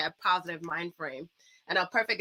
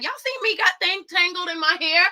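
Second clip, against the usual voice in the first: excited call-out; 9 semitones higher, 6 dB louder than usual. Retold or imitated. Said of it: "I was like".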